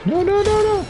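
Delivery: high, rising panic as the game goes wrong; panicked no. Real, not acted. Streamer watching his character die, panicked high no.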